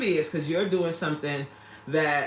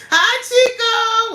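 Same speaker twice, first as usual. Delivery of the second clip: high, loud, sung-out hello; whooping welcome; excited greeting; 8 dB louder, much faster than usual. Real, not acted. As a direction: call out a delighted hello to a newcomer. Greets a viewer joining the live.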